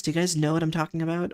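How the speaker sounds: ordinary talk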